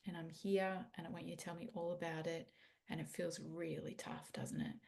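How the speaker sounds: put-on soft, near-whispered comfort for a hurting child; gentle comforting. Shown as a demonstration. Parent kneels to comfort son who wasn't picked, soft near-whisper. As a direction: hush it down and soothe, like kneeling beside him. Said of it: "take a deep breath, get down low and go".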